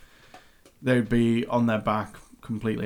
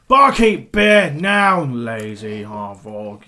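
rude tavern shout; 6 dB louder, much slower than usual. Acted. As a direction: shout for the barkeep, then drag out the insult. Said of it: "you hear people just going".

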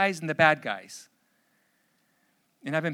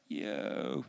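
much lower, drawn-out yo; slow stoned yo; 13 semitones lower, 8 dB quieter than usual. Shown as a demonstration. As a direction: stretch the yo out, dazed. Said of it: "So kinda say it slowly".

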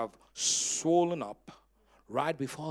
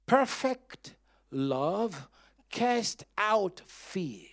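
solemn scripture reading; much slower than usual. Acted. Solemn reading of Bible verse.

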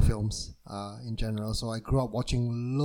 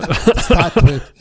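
laughing; 3 semitones higher, 10 dB louder, slower than usual. Real, not acted. Laughing at her own pronunciation.

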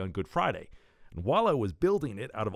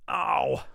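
loud, low groan of pain; 10 semitones lower, 5 dB louder than usual. Acted. Hurt after crash landing on the table, pained groan. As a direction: groan it out loudly.

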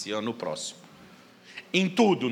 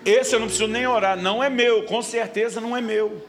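higher, louder insistence; emphatic retold prayer; 6 semitones higher, 6 dB louder than usual. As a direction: insist it's not yours. Pastor retelling his prayer.

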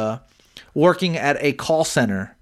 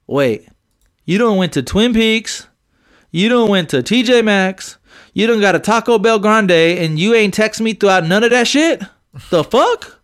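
higher, louder mock indignation building to a cry; exasperated outrage; 5 semitones higher, 3 dB louder than usual. Partner venting about an unreported day, mounting outrage. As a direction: act the fed-up partner, voice climbing. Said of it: "I'm thinking to myself".

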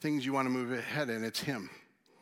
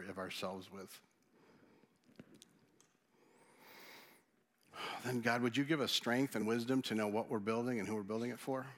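closing prayer. Acted. Pastor leads closing prayer.

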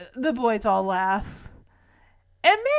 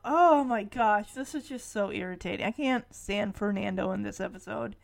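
ordinary talk, nothing marked

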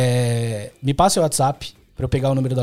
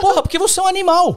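excited; 14 semitones higher, 4 dB louder than usual. Real, not acted. Outburst at the shop owner.

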